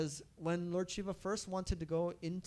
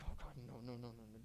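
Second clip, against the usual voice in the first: much softer, lower mumbled no's; embarrassed denial; 6 semitones lower, 13 dB quieter, much faster than usual. Acted. Shiva caught in disguise, embarrassed soft denials. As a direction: shrink away bashfully.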